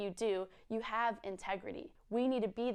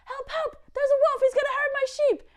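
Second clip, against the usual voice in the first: high, loud mock cry for help; boy crying wolf; 10 dB louder than usual. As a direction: yell like a panicked little boy. Boy cries wolf again.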